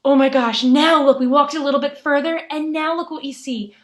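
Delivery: hammed-up urgency, drawing them to look; playacted breathless surprise; pretend excitement. Pretend discovery of more tracks.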